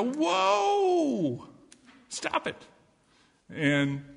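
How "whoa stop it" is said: squeaky, much higher worm yelp; startled worm. Acted. Little worm shouting up at the girl digging, high and startled. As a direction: yelp like a tiny creature whose home is hit.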